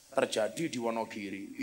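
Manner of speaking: ordinary talk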